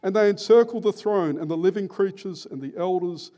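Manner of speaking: loud, sustained declamation; ringing, elevated Bible reading; proclaimed scripture reading. Real, not acted. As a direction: read the verse out as a rousing proclamation. Reads scripture aloud.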